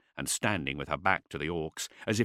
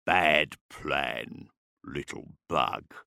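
low, slow orc taunt; deep guttural mockery; 6 semitones lower, much slower than usual. A narrator's reading. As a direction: rumble it down at a tiny foe. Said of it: "His Gothic was guttural".

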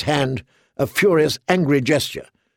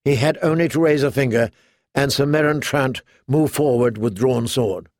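ordinary delivery, nothing marked

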